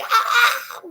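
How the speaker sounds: high, loud, laughing shriek; mock yelp. Under the needle next time, a laughing mock shriek.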